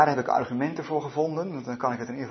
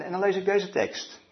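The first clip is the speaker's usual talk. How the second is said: usual speaking voice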